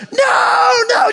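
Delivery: put-on frantic high child protest; panicked child. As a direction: squeal it like a scared little girl. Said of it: "just freaking out".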